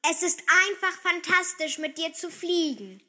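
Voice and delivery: put-on thrilled little girl; delighted. A narrator's reading. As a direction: gush with delight. Girl delighted to fly on unicorn.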